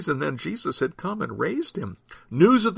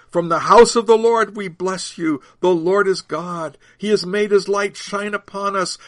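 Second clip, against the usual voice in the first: raised, ringing recitation; pilgrims' psalm; 5 semitones higher, 5 dB louder than usual. A narrator's reading. Pilgrims nearing the holy city, raised psalm chant. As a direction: declaim it high like a festal psalm, easing at the end. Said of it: "The pilgrims continued to sing".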